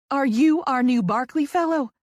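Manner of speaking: high, loud Southern greeting; eager question in a Southern accent; thick Southern accent. A narrator's reading. Woman greeting the new fellow, thick Southern accent, high and loud. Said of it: "She's got a thick Southern accent".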